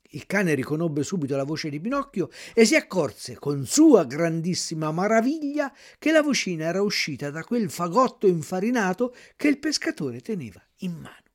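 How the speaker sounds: usual speaking voice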